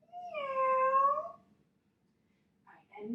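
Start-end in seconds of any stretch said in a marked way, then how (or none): 0.1-1.4 high rising kitty meow again; cat meow. Shown as a demonstration. Yoga teacher meows again with the kids. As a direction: give a sweet little cat's meow. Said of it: "one more time".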